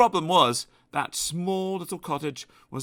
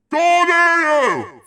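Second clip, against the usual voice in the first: much higher, very loud call; thundering grocer; 9 dB louder than usual. Acted. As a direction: boom it out. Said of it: "thundered the grocer".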